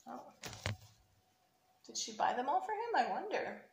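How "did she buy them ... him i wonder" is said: ordinary delivery, nothing marked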